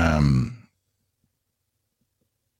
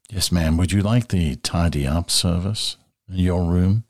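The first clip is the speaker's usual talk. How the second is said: lightly put-on British accent, polite hotel clerk; a touch of British, offering room service politely; British desk clerk accent. Host voicing a hotel desk clerk offering tidy up, mild British accent. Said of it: "the British accent you put on".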